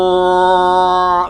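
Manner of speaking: drawn-out odd-voiced whoa; mock creature opening wide; playful pelican lunge sound. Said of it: "he just goes out and he's like".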